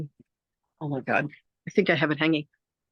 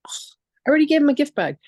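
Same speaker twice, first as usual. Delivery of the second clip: retold exasperated protest, higher, louder and quick; groan, then protest fast in a raised voice; 10 semitones higher, 5 dB louder, faster than usual. Retelling her protest to Spirit's demand, exasperated.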